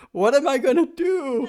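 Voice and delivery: hammed-up teen lament through laughter; mock-anguished Chuck. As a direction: wail it like a melodramatic radio boy. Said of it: "Chuck just says".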